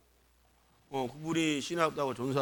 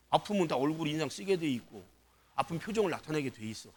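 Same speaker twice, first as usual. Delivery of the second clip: ordinary delivery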